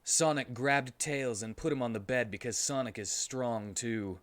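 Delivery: ordinary talk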